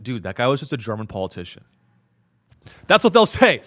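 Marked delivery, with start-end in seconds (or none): 0.0-1.6 low, quick mock-bro voice; imitated apologist. People defending a German politician, imitated apologist. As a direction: imitate a dismissive dude, pitched down and hurried. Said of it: "They'll be like".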